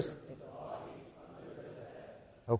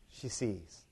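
ordinary delivery

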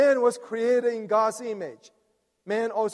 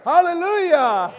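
high, loud rallying hallelujah; exultant shout; 9 semitones higher, 8 dB louder than usual. Real, not acted. Preacher cheering on the congregation's reading, exultant shout. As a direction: cry it out to fire up the room.

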